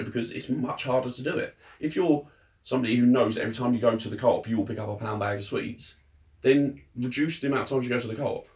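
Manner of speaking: ordinary delivery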